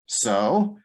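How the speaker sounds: normal talking voice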